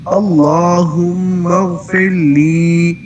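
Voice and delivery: اغفر لی with the لی drawn out long. Shown as a demonstration. Teacher showing the student a long لی at the end. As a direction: stretch the final لی slowly. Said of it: "لی پہ لمبا کرنا ہے".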